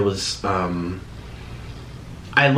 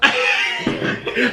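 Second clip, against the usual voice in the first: breathy laugh. Real, not acted. Laughing at the abrupt ending.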